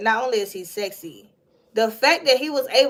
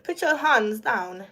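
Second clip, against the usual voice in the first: ordinary talk, nothing marked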